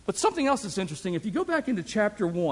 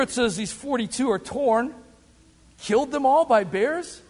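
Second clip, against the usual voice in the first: ordinary delivery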